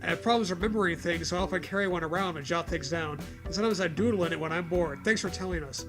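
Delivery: ordinary talk, nothing marked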